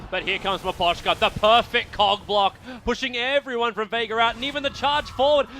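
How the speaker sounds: high, loud esports cast in full flow; excited play-by-play. Real, not acted. Caster calling a team fight as it happens, excited play-by-play. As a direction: drive the fight forward with rising excitement.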